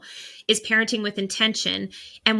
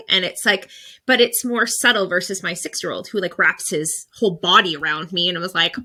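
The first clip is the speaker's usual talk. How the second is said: normal talking voice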